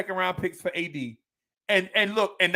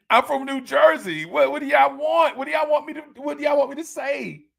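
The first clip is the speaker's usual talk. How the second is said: higher, louder defensive protest; excited higher pitched Jersey defense; defensive outburst; 6 semitones higher, 4 dB louder than usual. Real, not acted. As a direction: throw up your hands: what do you want. Defending his Jersey habits.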